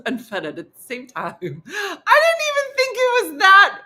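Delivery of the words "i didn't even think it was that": high, loud, through laughter; cracking up. Real, not acted. Woman telling the foyer story, cracking up. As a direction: barely get it out for laughing.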